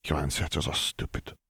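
soft, mildly sulky grumble; low-key, hushed sulk; pouting. A narrator's reading. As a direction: pout it quietly. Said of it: "she pouted".

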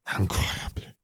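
soft, voiceless French mutter. A narrator's reading. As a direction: murmur it under the breath in disbelief. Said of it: "Fleur's mother muttered".

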